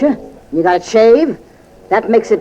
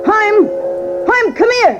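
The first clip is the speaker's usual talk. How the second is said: calling out; 14 semitones higher, slower than usual. Acted. Landlady hollering for her husband to come here. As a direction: shout for her husband in the back room. Wife calls husband from afar.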